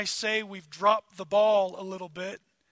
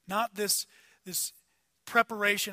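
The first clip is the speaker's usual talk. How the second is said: ordinary delivery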